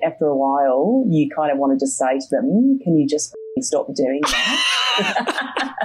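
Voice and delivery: mock Australian upspeak; Australian accent. Shown as a demonstration. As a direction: exaggerate the Aussie lilt, flicking phrase endings upward. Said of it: "add that little twing on the end".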